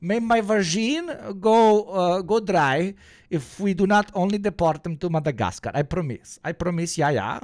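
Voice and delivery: mock German accent sliding into Borat; bad German-accented Hitler. Streamer as Hitler swearing a promise, bad German accent. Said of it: "your English German accent is horrible".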